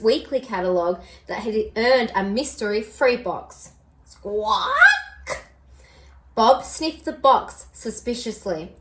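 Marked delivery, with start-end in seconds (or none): 4.0-4.7 high squawking bird cry; pelican squawk. A narrator's reading. Narrator does the pelican's squawk.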